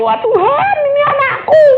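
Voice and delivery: very high, loud mock wail; acted-out loud plea to God; wailing protest. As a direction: cry out in exaggerated complaint. Retold protest to God about child.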